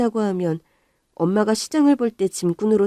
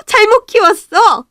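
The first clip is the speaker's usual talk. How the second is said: angry lament; 14 semitones higher, 11 dB louder, slower than usual. Acted. Mother repeats louder.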